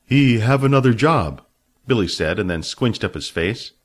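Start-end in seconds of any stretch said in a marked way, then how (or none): 0.0-1.4 broken English, louder; mimicking Fat. A narrator's reading. Billy answering Fat in Fat's way, a touch of mimicry. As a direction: slip into Fat's speech. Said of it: "started talking like him".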